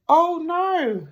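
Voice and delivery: high, loud exasperated groan; fed-up Mr Bear. Acted. Mr Bear kept awake by snoring, high exasperated cry. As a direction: wail it, at the end of your tether.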